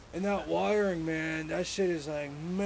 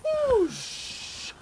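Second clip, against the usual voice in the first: whoosh noise; 4 dB louder than usual. Retold or imitated. Imitating the Camaro hatch.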